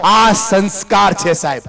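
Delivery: loud, high, impassioned sermon voice; a speaker thundering about values from the stage; fiery preaching. Acted. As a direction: preach it to the crowd at full force. Storytelling at full preaching force.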